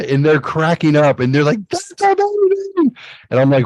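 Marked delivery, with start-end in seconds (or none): 1.7-2.9 very high, slowed that's amazing; hammed-up gleeful squeal; mock delighted pranksters. Pranksters cracking up.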